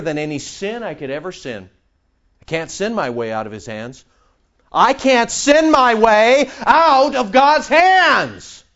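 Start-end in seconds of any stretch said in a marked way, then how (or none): 2.4-4.0 quiet assurance. Real, not acted. Preacher states his security in God, quietly assured. First take, before emphatic repeat. 4.7-8.6 high, loud, drawn-out preaching cry; triumphant declaration. Real, not acted. Preacher proclaims his security in God, loud and triumphant. Same line repeated at full force.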